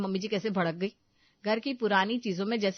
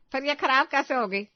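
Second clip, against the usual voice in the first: accusing; 5 semitones higher, 6 dB louder than usual. Acted. Mother-in-law accuses.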